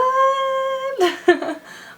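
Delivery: loud squealing laugh; a high, startled burst of laughter; shocked, loud laugh. Real, not acted. Reacting to being asked out.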